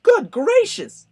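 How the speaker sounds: a shocked squawk from a bird; gasping bird. A narrator's reading. Maisie recognizing Horton, gasping very high and slow. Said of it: "gasped Maisie".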